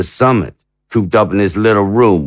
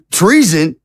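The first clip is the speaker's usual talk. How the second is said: incredulous laugh; 8 semitones higher than usual. Acted. Charged with treason.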